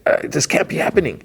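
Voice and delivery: high, strained mock dismay; talking to himself. Relieved conductor to himself, high strained retold dismay. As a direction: imitate a private panicky lament. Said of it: "And then to himself".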